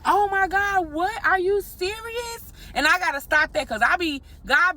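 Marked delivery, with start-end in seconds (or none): 0.1-2.5 high, amazed gasp that stretches out slowly; can't believe the gift; stunned disbelief. Retold or imitated. Receiving hundred dollar bill.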